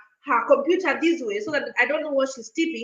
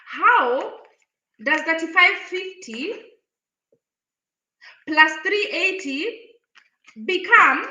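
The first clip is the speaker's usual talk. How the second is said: incredulous quote; 4 semitones higher, slower than usual. Retold or imitated. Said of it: "So I'm like".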